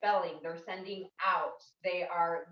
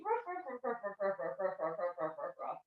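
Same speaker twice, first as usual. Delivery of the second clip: playful puppy yips, fading softer; puppy barks; 2 semitones lower, 4 dB quieter, faster than usual. Shown as a demonstration. Teacher demos little puppy yips, fading away. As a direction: yip like a little puppy, trailing off. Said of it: "here you can say".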